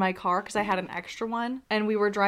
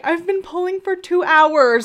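louder, higher, slow desperate whine; desperate friend; 9 semitones higher, 11 dB louder, slower than usual. Retold or imitated. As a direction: moan it like you've given up. Friend distressed, retold.

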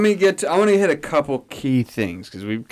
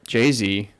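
normal talking voice

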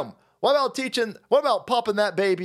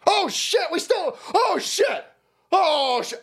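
much higher, laughing alarmed cries; mock panic; 12 semitones higher, slower than usual. Host playing the staffer's alarm, high laughing mock panic. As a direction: yelp it through a laugh.

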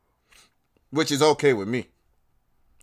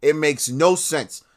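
normal talking voice

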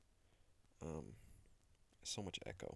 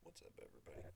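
whispered greeting; 2 semitones lower, 13 dB quieter, faster than usual. Acted.

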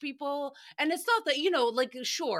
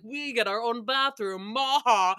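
mock snob; 5 dB louder than usual. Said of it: "they're like".

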